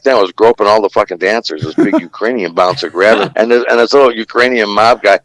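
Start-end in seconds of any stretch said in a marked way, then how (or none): none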